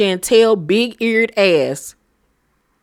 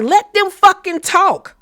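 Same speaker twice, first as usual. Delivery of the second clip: higher, slower mock demand; retold Evelyn; 10 semitones higher, slower than usual. Evelyn telling Denise to let them talk, mock high. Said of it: "And Evelyn was like".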